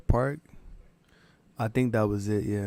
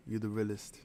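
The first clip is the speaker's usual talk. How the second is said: quiet repeat; 12 dB quieter than usual. Shown as a demonstration. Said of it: "you the realest right here".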